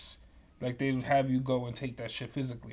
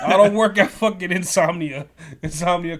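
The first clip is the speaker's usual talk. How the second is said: louder, amused indignation; indignant outburst; 3 semitones higher, 10 dB louder than usual. Real, not acted. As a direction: protest it with a laugh in the voice. Continues laughing rant.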